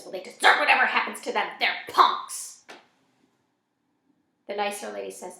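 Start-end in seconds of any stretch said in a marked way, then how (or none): 0.0-2.6 loud, quick, contemptuous outburst; mean old lady's loud scorn. A narrator's reading. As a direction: dismiss them harshly, hitting the last word. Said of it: "The mean old lady says".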